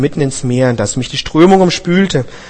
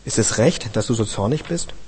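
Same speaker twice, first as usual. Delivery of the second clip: quiet divine voice; 3 semitones lower, 8 dB quieter than usual. A narrator's reading. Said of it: "Da sprach der Herr".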